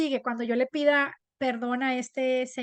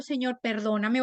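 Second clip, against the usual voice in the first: ordinary talk, nothing marked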